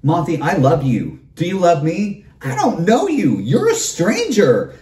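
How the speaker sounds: lightly hammed-up needy fan; mock clingy fan. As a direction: whine it like an overeager stranger. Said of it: "follow me around at venues saying".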